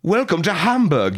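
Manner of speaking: screaming butler. Acted. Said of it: "your biggest butler screams".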